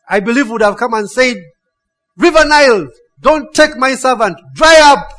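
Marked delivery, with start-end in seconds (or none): 2.2-5.1 loud, high, forceful order; booming divine command. Retold or imitated. God ordering the Nile to spare his servant, commanding shout. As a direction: command nature at full voice, as God.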